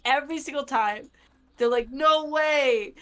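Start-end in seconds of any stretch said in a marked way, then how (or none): 1.9-2.9 raised, loud mock disbelief; hammed-up thrilled exclamation; starstruck fan. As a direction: imitate a fan blurting in amazement. Acting out amazed fans again.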